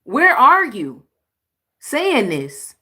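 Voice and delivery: high, loud double take; startled disbelief. Real, not acted. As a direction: stop the clip in shock. Reacting to clip in disbelief.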